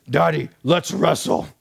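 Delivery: a light mock dinosaur growl from a boy; dinosaur roar voice. Father mimicking his son's playful dinosaur roar, lightly done. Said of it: "he roars like a dinosaur".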